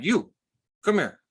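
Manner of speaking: mock a cop calling someone over; sheriff calling out. Sheriff to a man with a bloody nose, calling him over.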